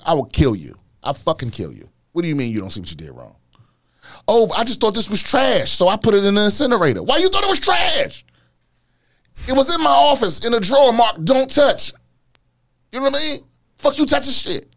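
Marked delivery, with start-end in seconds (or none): 2.1-3.4 lowered angry demand. Acted. Angry reply to culprit. 4.3-7.0 high, fast airy excuse. Acted. Culprit excuses himself. 7.0-14.7 high loud furious yell; outraged high shouting; furious rant. Acted.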